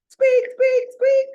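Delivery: high, loud squeaking like a little hamster; excited hamster squeak. A narrator's reading. Hamster joins cheering.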